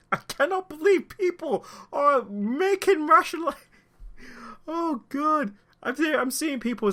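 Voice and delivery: very high, anguished real upset; emotional, breaking down. Real, not acted. Commentator overcome that people rationalize the killing, anguished high voice. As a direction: lose the fight against tears, voice shooting up. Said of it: "I'm trying not to be emotional".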